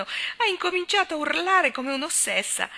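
high, loud, excited narration; heightened storytelling climax. A narrator's reading. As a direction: let the voice soar in mock alarm. Racconta l'urlo della governante. (racconto concitato, voce alta e acuta)

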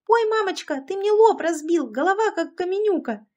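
very high pained wail; wailing yell. A narrator's reading. Second climber with a bumped forehead, wailing shrilly. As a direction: shriek the complaint in shock. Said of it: "услышали двойной вопль".

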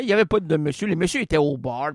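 ordinary talk, nothing marked

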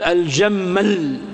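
الجمل said slowly with the ل held long and doubled; تشديد اللام في الجمل عند الوقف; doubled final. Shown as a demonstration. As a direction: lean on the last ل of الجمل. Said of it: "شدد، ضعف".

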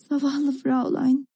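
sorry for her, slowly and tenderly; deep pity. A narrator's reading. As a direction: draw it out with compassion. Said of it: "dedi biri büyük bir üzüntüyle".

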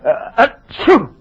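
big sneeze with a lead-in. Acted.

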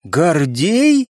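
mild astonishment, voice lifting; disbelieving repeat of a name; amazed. A narrator's reading. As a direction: echo the name as a stunned question. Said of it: "изумлённо переспросила Оксана".

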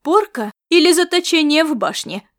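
громко и грозно, повысив тон; грозно требуй ответа; грозный голос. A narrator's reading. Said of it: "вопросил он грозным голосом". (грозным голосом, громко)

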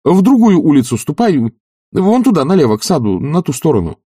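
high, loud urging; agitated master's orders; impatient directing. A narrator's reading. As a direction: send him off with urgent pointers. Oblomov impatiently directing servant away.

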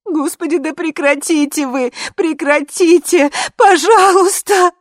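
high, distraught imploring; desperate plea. A narrator's reading. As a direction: beg them, rising to a drawn-out cry. Desperate plea, then she sobs.

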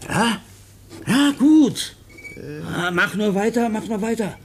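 high, eager urging; excited encouragement. Acted. Officer urging the writing boy on, high and eager. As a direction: spur him on, delighted.

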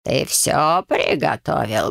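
low, slow sinister rasp; sinister wheeze. A narrator's reading. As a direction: wheeze it out ominously. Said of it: "другим каким-то зловещим голосом прохрипел".

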